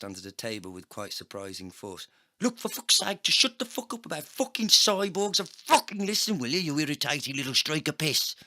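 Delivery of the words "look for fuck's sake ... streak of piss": loud, high furious rant; angry cyborg agent. A narrator's reading. Agent slamming the table at the narrator, loud furious rant. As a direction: slam the table and yell. Slams fist, yells at narrator.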